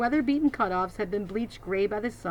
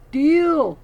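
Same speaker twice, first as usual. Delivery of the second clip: loud, high grunted word; 8 semitones higher, 12 dB louder than usual. Acted. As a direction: bark it out in one grunt. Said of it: "Bull grunted".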